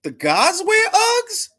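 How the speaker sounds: high, slow incredulous question; can't believe what he heard; disbelief. Real, not acted. Disbelief at Uggs news.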